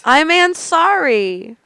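high, slow, drawn-out pun on sorry; punning mock apology. Comic apologizing with a pun on the surname, mock-sorry. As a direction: stretch out "Ansari" like a sheepish apology.

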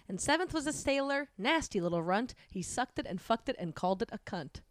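recited rhyme. Acted.

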